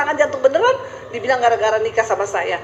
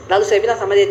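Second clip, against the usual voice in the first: normal talking voice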